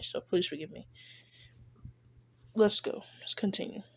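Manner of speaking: ordinary talk, nothing marked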